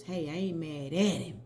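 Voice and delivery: ordinary delivery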